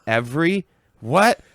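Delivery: baffled by the odd name; confused surprise. Retold or imitated. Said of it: "I'm like".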